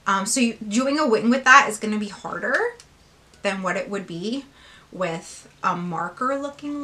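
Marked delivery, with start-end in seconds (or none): none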